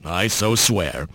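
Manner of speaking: low gruff growl; slow quiet gruff oath. A narrator's reading. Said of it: "gave a gruff".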